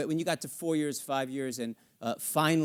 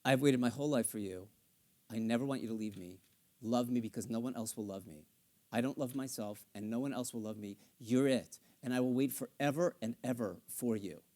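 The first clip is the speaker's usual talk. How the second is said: subtext spoken; 7 semitones lower, 6 dB quieter than usual. Shown as a demonstration. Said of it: "what am I really saying?".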